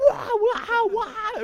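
high-pitched "wah" yelling; high wordless mock screaming. Sub past speaking English, mock screaming noises. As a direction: wail nonsense noises in mock hysteria. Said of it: "I'm just, like, making noises".

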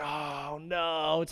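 soft, sinking mock dismay; mock dread. Sub as the kids come back, mock dread. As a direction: groan it quietly in dread.

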